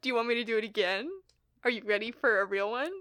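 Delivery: ordinary talk, nothing marked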